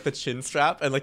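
ordinary talk, nothing marked

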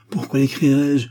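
loudly pretending to be offended; feigned indignation. A narrator's reading. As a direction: protest innocence with put-on outrage. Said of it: "indignation bien simulée".

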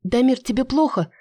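high, anxious question; worried. Acted. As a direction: ask him anxiously, voice jumping up. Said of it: "взволнованно спрашивает". (встревоженно, высоко)

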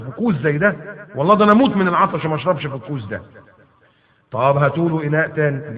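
usual speaking voice

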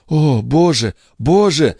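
high-pitched fearful exclamation, fully voiced; acted dismay, pitched well up; trembling alarm. A narrator's reading. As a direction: gasp out dread as a frightened heroine. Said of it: "прошептала Катрин".

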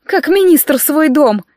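high, slow, needling tease; snide reminder. A narrator's reading. Colleague reminds raccoon about the minister, snide. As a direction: needle them with the comparison. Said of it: "ехидно напомнила Циара".

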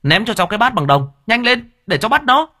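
high, fast shouted request; urgent call. Acted. Thiên shouts to the old man to throw the bronze bowl, urgent. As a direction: yell across for the bowl.

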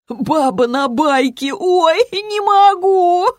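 high, slow, wailing hilarity; cracking up. A narrator's reading. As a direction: howl helplessly at a joke, drunk. Drunk pal cracking up at joke. (пьяное ржание сквозь слова)

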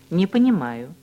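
ordinary delivery

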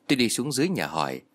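ordinary delivery

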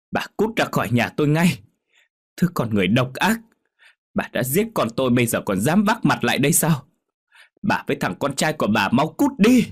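high, loud furious shout; furious chasing out. A narrator's reading. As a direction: drive her out of the house. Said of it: "đuổi thẳng". (bà Chín quát đuổi mẹ Đạt, la to giận dữ)

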